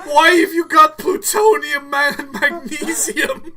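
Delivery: high, loud, cracking protest; hammed-up squeaky teenage alarm; Marty, voice cracking. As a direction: squawk it in exasperation with a voice crack. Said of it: "that slight voice crack".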